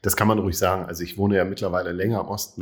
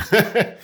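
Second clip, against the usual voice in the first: short laugh; 12 semitones higher, 6 dB louder than usual. Real, not acted. Laughs about learning nothing.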